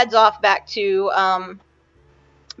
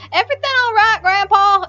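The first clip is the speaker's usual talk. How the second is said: put-on kid voice; 9 semitones higher than usual. Jonas, a kid, asks grandpa.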